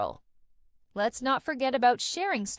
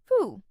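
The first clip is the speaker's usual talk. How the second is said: short, loud whew of relief; quick whew; 8 dB louder than usual. Shown as a demonstration. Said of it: "and then quickly said".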